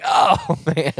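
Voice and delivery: high-pitched retold "oh man" groan; retold dismay. Quoting own reaction to missed doe.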